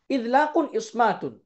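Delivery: slow, measured term pair; slow recitation. Shown as a demonstration. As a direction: spell it out slowly for learners. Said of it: "জোড়ায় জোড়ায় পড়বো".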